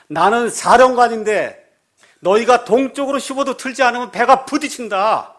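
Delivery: the commander pulls rank in rage; angry commander. Retold or imitated. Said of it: "사령관이 화가 나서".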